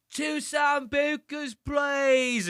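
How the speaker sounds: put-on high, slurred drunk shout of an order; wasted girl. Drunk girl shouting her order at the DJ booth, high slurred yell. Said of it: "she's so drunk. She's like".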